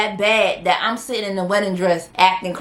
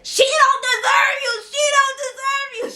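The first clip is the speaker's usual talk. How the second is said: much higher, sobbing yell; crying shout. Retold or imitated. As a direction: wail it like a jilted bride losing it. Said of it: "She standing up there".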